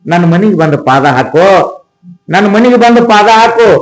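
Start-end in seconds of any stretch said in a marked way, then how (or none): 2.3-3.7 fervent plea. Acted. Merchant pleading with the sage to visit his home, raised imploring voice. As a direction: beg again, raising the voice.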